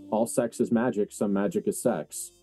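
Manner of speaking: ordinary talk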